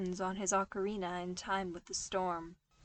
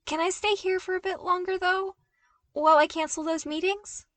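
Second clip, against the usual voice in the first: much higher, louder and fast, words tumbling out; blurting out; 11 semitones higher, 8 dB louder than usual. Acted. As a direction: rush the request before losing nerve. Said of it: "before blurting out".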